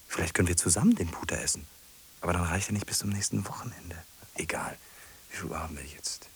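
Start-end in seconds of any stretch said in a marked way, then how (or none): 2.2-6.3 quick hushed whisper. Acted. Man thinking aloud about visiting her, whispered. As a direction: rush it out, barely voiced.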